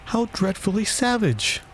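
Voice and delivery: high-pitched dismayed exclamation; shocked exclamation. A narrator's reading. Alice reacting to the Queen's sentence, exclaiming. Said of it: "exclaimed Alice".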